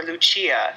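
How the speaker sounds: "Lucia" said loo-CHEE-uh, Italian style; slow, careful "Lucia" as loo-CHEE-uh; pronunciation with ch. Shown as a demonstration. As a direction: say "Lucia" with a ch sound in the middle. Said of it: "because it could be".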